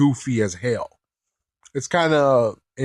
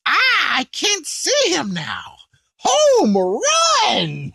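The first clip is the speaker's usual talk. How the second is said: very high, laughing squeaky cheer; gleeful Babidi; 14 semitones higher, much slower than usual. Retold or imitated. Babidi watching Gohan fly off, high laughing cheer. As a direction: crow it like a tiny delighted villain. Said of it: "And Babidi's like".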